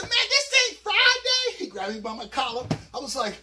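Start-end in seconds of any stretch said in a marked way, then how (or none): 0.0-1.6 much higher, loud, slow; panicked protest. Retold or imitated. Narrator protesting to Cannon. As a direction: plead it in alarm. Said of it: "I said".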